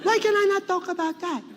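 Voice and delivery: very high, indignant grandmother; Lola Tinay. Retold or imitated. Grandmother objects to being hushed by grandson, high and indignant. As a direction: protest in a high, put-on grandmother voice. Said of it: "and she'd be like".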